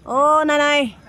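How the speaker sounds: loud high hail; loud high call to grandma up the house. Real, not acted. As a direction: shout her name across the gap. Calling to grandma.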